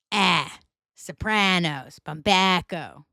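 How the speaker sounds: Ah as in cat, Sopranos, Bumbaco to match; local flat A. Shown as a demonstration. Podcaster drills her accent's A, slow PRAN and BACK. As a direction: stretch the short A in Ah, Sopranos, Bumbaco. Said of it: "But we say".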